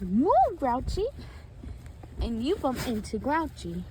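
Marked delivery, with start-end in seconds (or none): 0.0-1.1 high, loud order; raised, pushy high voice; Jokey. Acted. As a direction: call out to clear the way. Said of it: "And Jokey says".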